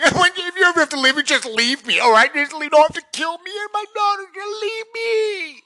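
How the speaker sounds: a hammed-up sobbing plea to leave her, not kill her; imitating crying wife. Husband mimics his crying wife begging him, in a high mock wail. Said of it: "looking at me like".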